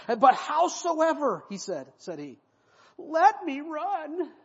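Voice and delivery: high, very slow whiny plea; a young man whining to his commander to let him run. Acted. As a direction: whine and drag out the begging. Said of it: "He's whining".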